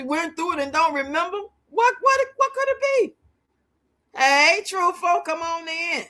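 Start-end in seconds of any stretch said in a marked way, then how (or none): none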